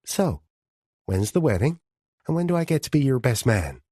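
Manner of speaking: a light, lifted teasing lilt. A narrator's reading. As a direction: needle him about the wedding with a grin. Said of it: "in a teasing voice asked".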